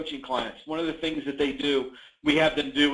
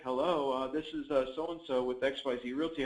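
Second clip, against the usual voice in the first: imitating a deflated caller, lower and much softer; Debbie Downer caller; 3 semitones lower, 9 dB quieter than usual. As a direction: mumble the greeting like a bored, joyless agent. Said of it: "your entire tonality changes".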